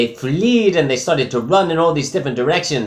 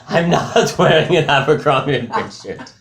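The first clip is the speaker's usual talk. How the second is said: laughing through the words; 4 semitones higher than usual. Real, not acted. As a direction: denial broken by giggles. Laughing denial of the shirt.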